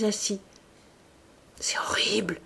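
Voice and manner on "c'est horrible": breathless whisper, much softer; horrified whisper. A narrator's reading. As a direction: whisper the shock. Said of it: "leur jeta-t-il".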